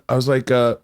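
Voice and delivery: ordinary talk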